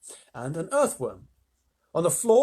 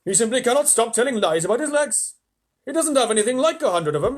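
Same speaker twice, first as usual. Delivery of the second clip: higher, louder complaining earthworm; 5 semitones higher, 6 dB louder than usual. Acted. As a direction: grumble high and peevish. Earthworm voice continues.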